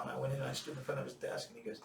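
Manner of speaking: ordinary delivery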